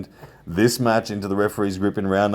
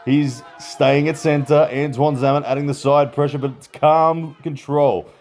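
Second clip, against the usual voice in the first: excited commentator calling the action; live play-by-play; 4 semitones higher than usual. Real, not acted. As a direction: call the pull live, higher and urgent.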